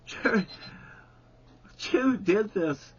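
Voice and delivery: ordinary delivery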